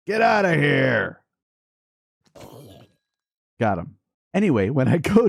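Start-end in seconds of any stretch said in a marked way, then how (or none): 0.1-1.2 shout. Real, not acted. Shooting at a mob.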